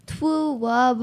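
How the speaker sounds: put-on lisping old clergyman; mock "twoo wub" lisp; Princess Bride priest. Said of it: "Have you seen that movie?".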